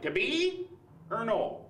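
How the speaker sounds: mock actor with a broad Wisconsin twang; Wisconsin Shakespeare. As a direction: declaim it in a hammed-up Wisconsin accent. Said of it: "the Wisconsin Shakespeare Festival".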